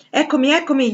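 high, loud answering call; calling back. A narrator's reading. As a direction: call out that you are coming. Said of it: "gli fu risposto". (risposta gridata da lontano)

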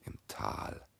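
fading to a breathless whisper; hushed as the vision sinks. Acted. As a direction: let the last words die away. Peer's vision fades into darkness.

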